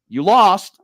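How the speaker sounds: loud, high mock proclamation; blunt retold announcement. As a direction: call it out like a verdict. Tournament official announcing his loss.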